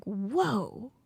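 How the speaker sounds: hammed-up hurt whine; mock hurt. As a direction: whine like a wounded child. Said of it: "I'm like".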